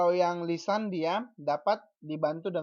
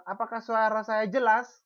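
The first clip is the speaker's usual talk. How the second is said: demo question; 4 semitones higher, 6 dB louder than usual. Shown as a demonstration. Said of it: "Saya katakan".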